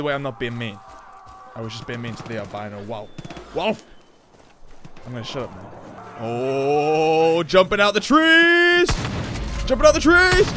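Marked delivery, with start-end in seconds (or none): 6.2-10.5 loud, high, thrilled yelling; excited shout. Real, not acted. Excited at enemies leaping down.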